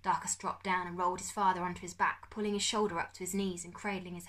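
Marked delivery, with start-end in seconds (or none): none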